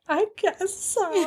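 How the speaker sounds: tiny, high, drawn-out voice; imitated sheepish agreement, soft and high; meek reluctance. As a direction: shrink into a slow, hesitant teen squeak. Retells own timid reply.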